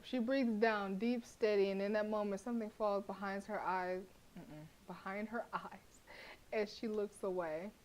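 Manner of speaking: measured narrator read; story narration. A narrator's reading. Narrator describing the tense silence, story voice. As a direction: set the scene like reading a novel.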